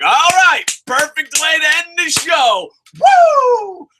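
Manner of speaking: high, loud cheer ending in a whoop; thrilled whoop; excited cheer. Retold or imitated. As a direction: celebrate like the show just peaked. Quoting own cheer at show's end.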